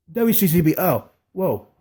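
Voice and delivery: usual speaking voice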